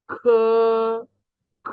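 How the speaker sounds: খ with a puff of air, vowel held; Chinese K sound. Shown as a demonstration. Teacher showing how K is pronounced, aspirated খ. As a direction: draw out an aspirated খ. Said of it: "কে এর উচ্চারণ হবে খ এর মতো".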